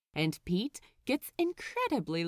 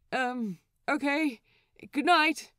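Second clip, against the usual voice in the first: awkward goodnight; 6 semitones higher, 5 dB louder than usual. Acted. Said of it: "an awkward and entirely unnoticed".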